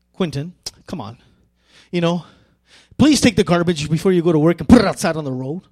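brisk, pushy, fast request; impatient demand. Shown as a demonstration. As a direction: rush him, a little annoyed. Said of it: "Same words, but two different tones".

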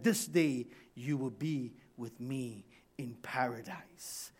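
softly reassure, nearly whispering; soft assurance. Acted. Jesus to the dying thief, soft assurance.

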